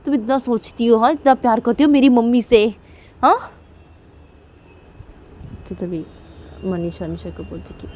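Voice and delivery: usual speaking voice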